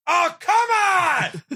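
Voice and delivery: high, drawn-out exasperated shout; the whole audience howling in disappointment; a theater full of let-down fans; mock crowd groan. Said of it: "the whole theater would be going".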